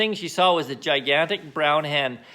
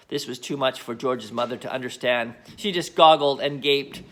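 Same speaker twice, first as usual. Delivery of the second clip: ordinary delivery, nothing marked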